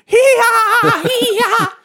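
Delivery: very high braying hee-haw laugh; donkey bray laugh. Pretend. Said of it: "a clip of me laughing".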